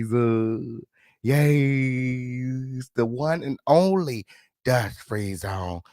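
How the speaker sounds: usual speaking voice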